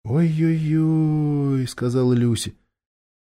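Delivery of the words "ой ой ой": drawn-out, higher pitying oh dear; dismayed. A narrator's reading. Girl watching the hurt, deluded dwarf, dismayed. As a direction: sigh it out slowly in dismay.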